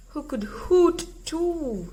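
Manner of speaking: ordinary delivery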